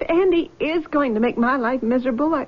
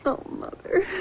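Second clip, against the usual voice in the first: soft, weary, whispered sigh; breathy whisper; 2 semitones higher, 7 dB quieter than usual. Acted. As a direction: barely whisper it, exhausted. Weak daughter sighs to mother.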